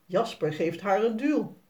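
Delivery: ordinary delivery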